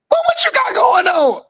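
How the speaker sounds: very high, shouted demand; mock outraged father yelling down the phone; retold stern shout. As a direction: demand an answer like a shocked dad. Stern dad questioning son on phone.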